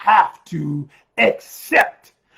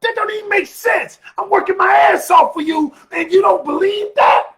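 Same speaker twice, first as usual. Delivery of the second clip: much higher, shouted, exasperated; indignant retort; 9 semitones higher than usual. Retold or imitated. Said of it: "And I'm like".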